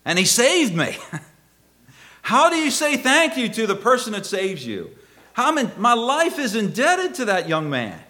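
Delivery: high, loud, overflowing pulpit gratitude; impassioned preaching. Real, not acted. Preaches rescue at full force.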